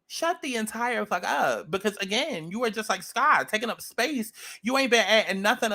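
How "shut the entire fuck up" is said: each word spaced out; slow, pointed command; slow and deliberate. Real, not acted.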